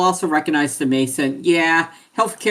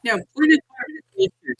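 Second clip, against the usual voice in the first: much higher, silly squeaky pitch; 11 semitones higher, faster than usual. Pretend. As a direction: pipe it up high like a cartoon. First take, then repeated plainly.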